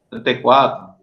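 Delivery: normal talking voice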